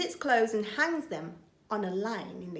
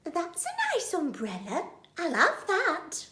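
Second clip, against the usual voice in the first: lady burglar's voice; 8 semitones higher than usual. Acted. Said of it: "a voice says".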